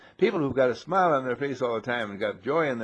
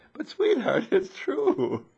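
high, laughing protest to wife; pleading with a laugh; laughing plea; much slower than usual. Retold or imitated. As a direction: giggle that it really happened.

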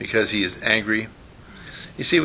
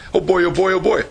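higher-pitched acted eagerness; mock glee; 5 semitones higher than usual. Gang of kids coming over to pick on him, mock glee. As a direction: play kids delighted at the invitation.

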